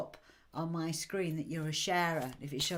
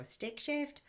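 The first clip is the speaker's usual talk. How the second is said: puzzled American; 9 semitones higher than usual. Retold or imitated. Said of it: "They go".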